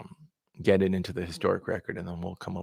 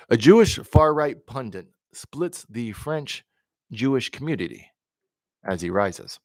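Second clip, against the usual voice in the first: steady, measured newsreader delivery; news read; 4 semitones higher, 9 dB louder than usual. Acted. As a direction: read the story like a broadcast bulletin. Reads news articles aloud.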